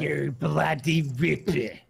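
slow, exaggerated Australian accent; hammed-up Australian slang; mock Australian accent. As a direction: drawl it like a stage Australian.

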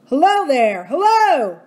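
loud, high hail across the water; calling out. Acted. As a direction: shout hello toward the rock. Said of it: "Then they all started calling".

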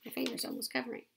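ordinary delivery, nothing marked

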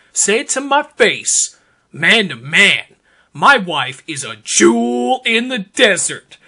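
normal talking voice

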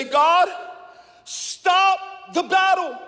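high, slow, loud plea to God; pleading cry. Acted. Believer at the altar begging God, crying out. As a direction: cry out slowly for rescue.

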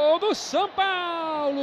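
long held goal cry; explosive goal commentary. Real, not acted. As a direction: stretch out the goal call. Goal call. (grito de gol prolongado)

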